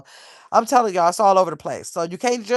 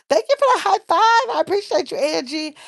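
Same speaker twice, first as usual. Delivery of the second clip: delighted thanks; 11 semitones higher than usual. Real, not acted. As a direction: squeal your gratitude up high. Thanking viewer for high five.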